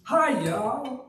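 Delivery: a playful Southern US hello; Southern American greeting. Retold or imitated. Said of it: "Southern America".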